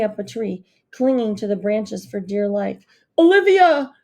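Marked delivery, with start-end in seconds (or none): 3.2-3.9 much louder, higher desperate bellow. A narrator's reading. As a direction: roar her name from the tree. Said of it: "the Duke bellowed".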